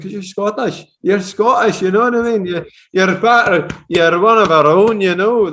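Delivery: high, loud Scottish brogue; imitated Scottish accent. Host showing off accent to Scottish customers, Scottish. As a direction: pitch it up and go full Scottish. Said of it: "do you mind if I imitate your accent?".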